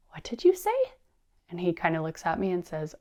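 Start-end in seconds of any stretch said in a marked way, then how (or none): 0.1-0.8 high-pitched mock surprise; playful astonishment. Mother amazed at son saying okay, high mock surprise. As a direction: act amazed at what you just heard. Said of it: "And I gasped".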